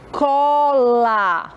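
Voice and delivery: "cola" drawn out as co... la; syllable-by-syllable reading. Shown as a demonstration. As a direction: read "cola" very slowly for beginners. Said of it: "Vamos ler com a tia".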